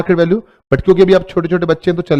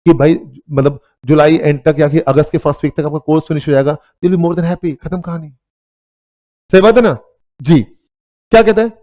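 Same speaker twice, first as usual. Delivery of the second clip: usual speaking voice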